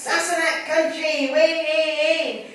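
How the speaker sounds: high, loud gleeful whooping; excited whoop. Retold or imitated. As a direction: cheer like a kid on a school trip. Friend whoops on the train.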